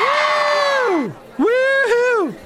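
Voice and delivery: very high, drawn-out whooping; shrieky cartoon whoops. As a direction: let out two falsetto woo-hoos. Whooping after the impression.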